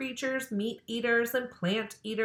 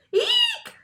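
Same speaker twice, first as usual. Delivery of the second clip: shrill shriek far higher; scream; 7 dB louder than usual. A narrator's reading. Girls seeing a snake in class, a high scream. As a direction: squeal in fright at the snake. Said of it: "screamed all the girls".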